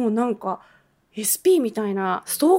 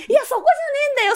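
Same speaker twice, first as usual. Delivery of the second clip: high, fast, laughing "いや、そこじゃねえんだよ", thrown off in disbelief; laughing exasperation; 14 semitones higher, faster than usual. Retold or imitated. Speaker inwardly retorting to hotel staff missing the point, laughing high exasperation. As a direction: laugh it out high and quick, that's not the point.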